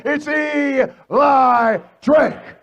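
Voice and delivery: slow, stretched name shout; milk every syllable of the name; ring-style drawn-out self-introduction; drawn-out catchphrase. Acted. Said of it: "catchphrase drawn out".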